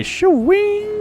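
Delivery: high squealing mock Wayne's World cry; Wayne and Garth. Quoting Wayne's World to the chat, high squeal. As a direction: squeal it like a teen on the couch. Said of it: "As Wayne and Garth would say".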